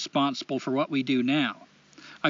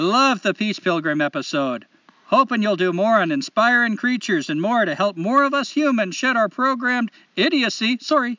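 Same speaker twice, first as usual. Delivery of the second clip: put-on Canadian accent; 3 semitones higher than usual. Host reading a listener's letter aloud, hammed-up Canadian accent. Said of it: "in the best Canadian accent I know".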